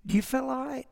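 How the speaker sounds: lightly voiced quiet fake concern; [name] teasing Barney with a soft worried question; mock concern. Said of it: "He just said it like that".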